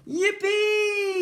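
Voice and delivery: high, loud joyful yippee; yell of delight. A narrator's reading. Ned bouncing on the slimeline, yelling with delight. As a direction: whoop it with pure delight. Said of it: "yelled with delight".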